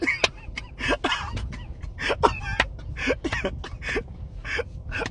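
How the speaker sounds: breathless high-pitched cackle; high squealing laugh. Real, not acted. Vlogger cracking up at her own joke. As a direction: let out a squeaky wheeze of a laugh.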